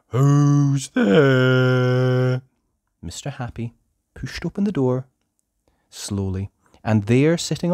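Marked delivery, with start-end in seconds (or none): 0.1-2.4 louder, long sad squeaky call. A narrator's reading. Sad voice behind the door calls to the knocker, squeaky and drawn-out. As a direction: wail it out slowly, miserable and squeaky. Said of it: "sad, squeaky sort of voice".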